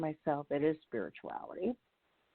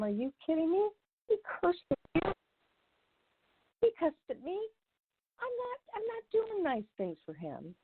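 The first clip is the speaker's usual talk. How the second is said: high-pitched, indignant protest; indignant arguing; 13 semitones higher than usual. Retold or imitated. Refusing her higher power's advice, high indignant protest. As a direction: push back hard, much higher, outraged. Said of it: "And I start arguing".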